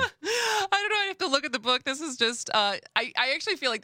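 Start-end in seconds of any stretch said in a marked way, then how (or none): 0.1-0.7 bursts into a high giggle; high-pitched laugh. Real, not acted. Laughs at the term.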